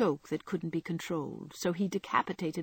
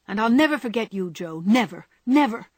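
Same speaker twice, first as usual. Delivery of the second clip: loud, fast insistence, never punched; passionate; 3 semitones higher, 7 dB louder than usual. A narrator's reading. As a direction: vow it with a stamp. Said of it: "emphasize his passionate words".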